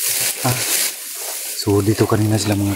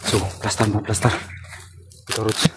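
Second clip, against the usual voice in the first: whispering while hiding; 7 dB quieter than usual. Real, not acted. Giving orders while hiding.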